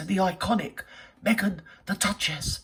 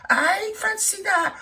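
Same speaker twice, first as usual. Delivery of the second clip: high slow mock shriek; hammed-up shocked squeal; mock outrage; 13 semitones higher, slower than usual.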